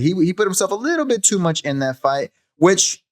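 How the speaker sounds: normal talking voice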